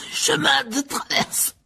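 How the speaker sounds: high, slow, choked-out stammer; stammered through coughs. Acted. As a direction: force the address out while choking. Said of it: "balbutia-t-il en toussant". (bredouillé en toussant)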